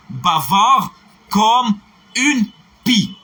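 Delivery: slow, each word set apart; teacher's slow model line; slow clear model phrase. Shown as a demonstration. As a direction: say it slowly for the class. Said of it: "c'est quelqu'un qui parle".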